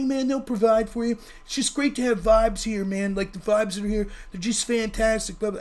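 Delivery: imitated hippie, easygoing and rambling; fake hippie. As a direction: drone on amiably like a festival hippie. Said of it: "my fake hippie voice there is".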